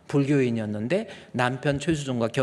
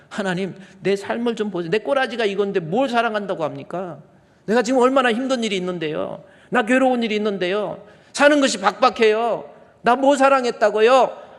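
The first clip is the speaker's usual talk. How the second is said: rising, louder whiny protest to God; complaining believer; 8 semitones higher, 6 dB louder than usual. Retold or imitated. Believer complaining to God, building to a loud whine. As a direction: plead your hard life at God, getting louder.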